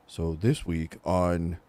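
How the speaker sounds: low, slow, soft deadpan; mock monotone host intro; Chad impression. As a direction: drop low and drag it out softly. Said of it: "trying to do for my impression".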